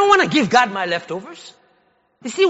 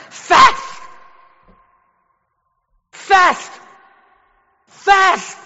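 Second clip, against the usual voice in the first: loud, higher, one word punched each time; shouted command; 5 semitones higher, 4 dB louder, much slower than usual. Real, not acted. Preacher commanding the congregation to fast, in a loud shout. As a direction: bark the word out like an order.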